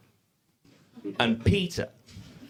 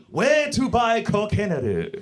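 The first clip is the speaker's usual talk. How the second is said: fumbling tourist Japanese; 4 semitones higher, slower than usual. Retold or imitated.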